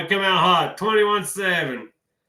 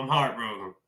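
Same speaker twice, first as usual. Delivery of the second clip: the echo of a real heartbreak, said lower and softer; soft sad repeat; 7 semitones lower, 6 dB quieter than usual. Real, not acted. Repeats his heartbreak line twice.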